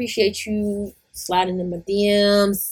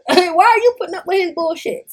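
incredulous yell; 10 semitones higher, 5 dB louder than usual. Real, not acted.

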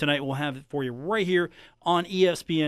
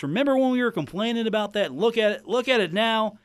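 put-on raised cranky old-man tone; 7 semitones higher, 3 dB louder than usual. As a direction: play a grumpy old-timer. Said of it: "me in my old man voice".

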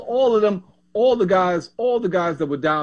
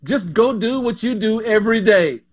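lightly voiced country coach; easy Southern coach voice; 5 semitones higher than usual. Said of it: "just like in that country voice".

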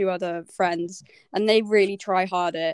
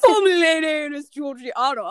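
laughing; 5 semitones higher, 4 dB louder than usual. Real, not acted. Teasing sister with full name.

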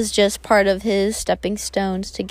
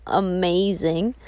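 ordinary delivery

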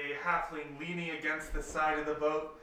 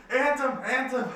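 raised, high-pitched call to a friend; 7 semitones higher, 8 dB louder than usual. Acted. Tang runs up to Anthem on the cliffside, calling out. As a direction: call the name loudly across a distance.

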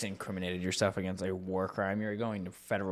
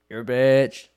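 playful shout; 3 semitones higher, 13 dB louder, slower than usual. Real, not acted. Playful insult yelled.

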